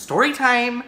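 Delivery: high, loud catchphrase call; playful announcement. Pretend. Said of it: "I just like saying that".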